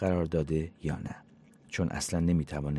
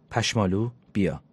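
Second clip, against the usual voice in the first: louder call, name rising up; calling the cat; 3 semitones higher, 5 dB louder than usual. Acted. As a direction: call the cat from across the room. (صدا زدن گربه با صدای بلند و کشیده)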